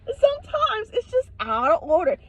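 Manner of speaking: high, laughing indignation; comic high-pitched disbelief; incredulous outrage. Acted. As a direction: protest in a high, tickled voice. Outraged reaction, repeated with laugh.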